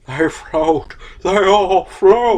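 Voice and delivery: slow, eerie clown voice; haunting clown whisper-tone; creepy clown voice. Retold or imitated. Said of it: "Unless you want me to be like".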